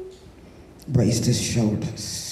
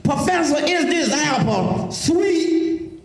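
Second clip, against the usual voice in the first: a lightly voiced country preacher, a bit higher, slowing on the question; plain-spoken old man; 13 semitones higher than usual. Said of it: "He said".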